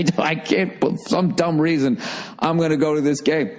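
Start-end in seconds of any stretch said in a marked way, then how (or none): none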